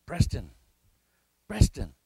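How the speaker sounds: loud urgent call of a name; urgently calling out to a friend. Retold or imitated.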